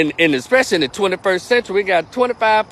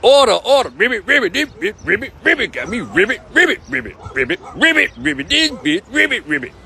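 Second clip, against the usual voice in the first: angry croaking ribbits, higher; mad frog; 4 semitones higher than usual. Pretend. The mad frog on the judge's desk, croaking angrily. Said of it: "then the frog get mad".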